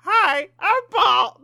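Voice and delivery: high, slow hooting monkey voice. Pretend. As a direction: squeak it out slowly like a monkey. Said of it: "I'm gonna do it again".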